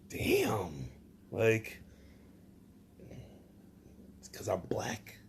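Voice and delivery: hushed whisper, barely voiced; whispered aside. Comedian whispering a sly aside to the audience. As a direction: breathe it to yourself, unheard.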